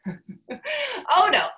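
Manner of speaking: high squeaky laugh through the words. Real, not acted. Telling the audience her panic, laughing.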